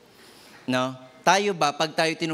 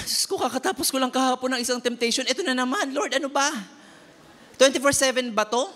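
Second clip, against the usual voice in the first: higher-pitched mock whine to God; pitched-up, overwhelmed grumbling prayer; exasperated complaint; 6 semitones higher than usual. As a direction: act fed up with endless trials. Acts out fed-up complaint to God.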